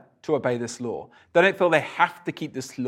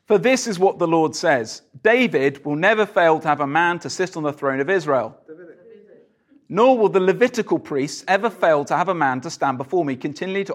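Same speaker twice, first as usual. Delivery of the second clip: louder, declaiming Bible reading; scripture reading; 5 semitones higher, 5 dB louder than usual. Acted. Preacher reading Jeremiah to the congregation, louder declaiming. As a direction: proclaim the passage to the room.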